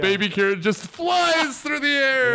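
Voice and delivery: high, mounting excited delivery; hyped retelling. Retold or imitated. Podcaster hyping up the baby-carriage scene, excited. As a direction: build to an excited high peak on the flying carriage.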